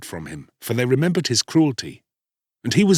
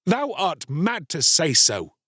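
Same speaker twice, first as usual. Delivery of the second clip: higher, slow indignation; a touch of anger, drawn out; angry cry; 7 semitones higher, slower than usual. A narrator's reading. Star-Child rejecting the woman's claim, mildly angry. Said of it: "cried the Star-Child angrily".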